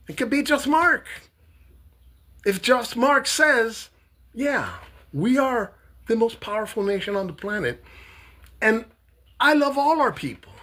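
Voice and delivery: high, put-on everyman voice; lightly mocking Mark impression; voicing Mark.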